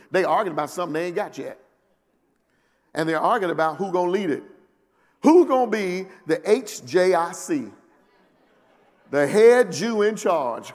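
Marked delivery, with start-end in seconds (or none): 5.2-10.7 slow punchline. Real, not acted. Preacher's punchline, slowed.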